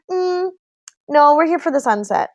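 matter-of-fact refusal. Retold or imitated. Friend refuses wife a second time, retold matter-of-fact. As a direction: decline politely and don't budge. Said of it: "It was just so matter of fact".

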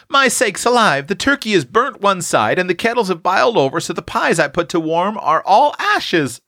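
put-on high, fussing country-girl scold. A narrator's reading. Tilly finds the dinner burnt, high flustered scolding. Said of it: "scolded Tilly".

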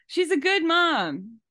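high and slow, syrupy admiration; gushing praise. Acted. Host praising Carrie Ann to needle Allie, gushing. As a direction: coo it like an exaggerated compliment.

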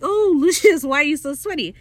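high, loud mock-shocked gasp of a question; surprised Steed. Steed finding Lucius sweaty, high mock surprise. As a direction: play the clueless dandy, startled.

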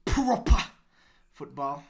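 big punch on proper, then drop; hammed-up jab at the British; emphatic proper football. Mock British pride in football.

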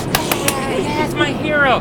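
high mock cheer; imitating cheering passengers. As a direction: celebrate like the crowd. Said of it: "everybody on the compartment cheers".

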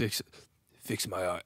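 very low, quiet brute voice; attempted low tough-guy impression; Drax. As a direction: drop right down to a soft bass. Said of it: "he's low".

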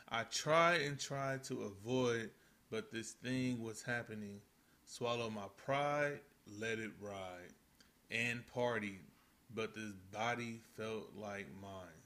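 slow, deliberate lyric reading; slow lyric recital. Shown as a demonstration. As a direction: read the lyrics slowly and firmly.

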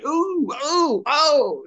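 high, imitated oohs; mock crowd gasps. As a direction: ooh and oh like shocked onlookers. Imitating Peach and onlookers reacting.

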